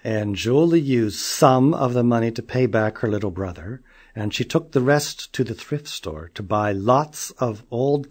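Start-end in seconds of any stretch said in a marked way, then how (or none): none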